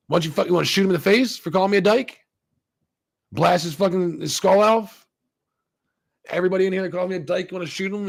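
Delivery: imitating an exasperated tirade; mock outraged rant. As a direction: go off on him with rhetorical questions. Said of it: "she fucking goes off on him".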